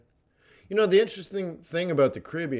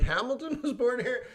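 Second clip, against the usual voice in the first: much higher, laughing disbelief; mock amazement; 10 semitones higher, 3 dB quieter than usual. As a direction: act stunned by the news. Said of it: "You're like".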